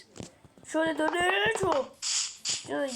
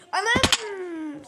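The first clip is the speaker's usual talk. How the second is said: sudden loud cry of dismay; startled exclamation; 5 semitones higher, 6 dB louder than usual. Real, not acted. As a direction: blurt out in shock. Dies in game, exclaims.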